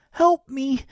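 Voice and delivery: high, loud cry for help; desperate plea. A narrator's reading. Boy in freezing water begging for rescue, high desperate plea. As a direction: plead as if going under.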